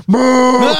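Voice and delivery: loud, high revving "moo"; mock engine bellow; imitated car roar. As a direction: do the engine as a big lowing noise.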